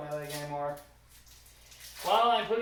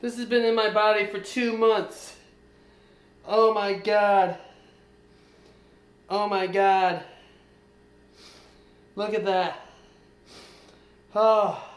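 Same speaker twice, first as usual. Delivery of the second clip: loud, drawn-out cries of disbelief; stunned, can't believe it's out; real amazement; 3 semitones higher, 6 dB louder than usual. Real, not acted. Metal finally out of leg.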